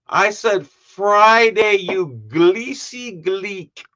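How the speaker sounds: loud, high, drawn-out acted anger; hammed-up slow insult with L for R; mad restaurant man.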